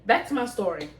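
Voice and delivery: ordinary talk, nothing marked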